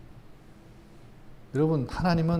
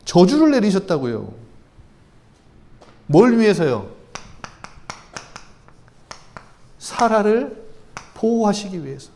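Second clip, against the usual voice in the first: louder, rising preacher's voice, driving the point home; fervent preaching; 4 semitones higher, 7 dB louder than usual. Acted. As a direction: preach it out with full conviction. Preaching at full force.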